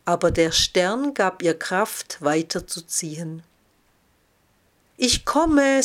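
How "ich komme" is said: higher, louder hopeful call. A narrator's reading. Snail answering the star that gives strength, calling out. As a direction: call ahead with new resolve, lightly voiced.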